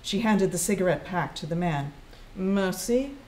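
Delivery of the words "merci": emotionless. A narrator's reading. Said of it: "also without emotion or recognition".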